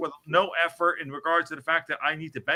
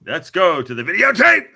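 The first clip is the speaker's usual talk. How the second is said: big booming catchphrase, much louder; announcer shout; 3 semitones higher, 10 dB louder than usual. Shown as a demonstration. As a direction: shout it like a TV host.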